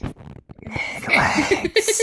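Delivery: sudden very high squeak on words; squeaky voice. Pretend. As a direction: squeal the last word way up high. Squeaky teasing repeat.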